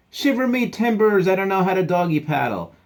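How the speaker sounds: high, loud pirate distress cry; panicked captain. Acted. Captain who cannot swim, loud acted panic. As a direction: holler for help from the water.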